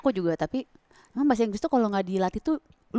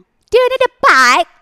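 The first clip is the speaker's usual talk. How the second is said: slow, much higher, louder repeat of the question; cashier asking; 11 semitones higher, 12 dB louder, much slower than usual. Retold or imitated. London cashier repeating the question slowly and loudly. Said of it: "Hampir kita mau nangis".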